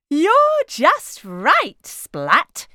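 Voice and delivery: high, slow, gentle praise; kind doctor. A narrator's reading. Doctor praising Splat during the exam, kind. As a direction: reassure a nervous patient.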